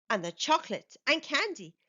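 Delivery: ordinary delivery, nothing marked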